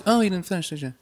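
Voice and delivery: high, fake-sweet jab; neighborly shit talk. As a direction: imitate a passive-aggressive neighbor. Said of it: "neighborly shit talk".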